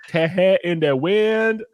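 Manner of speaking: high, slow mock Southern accent put on by an Irishman; bad Southern accent. Mocking Liam Neeson's Southern accent in the movie, slow hammed-up Southern accent. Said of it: "he's trying to have a Southern accent".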